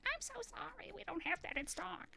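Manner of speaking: put-on high work voice; high customer-service voice. Shown as a demonstration. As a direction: pitch way up to sound nicer to customers. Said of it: "if my voice is higher".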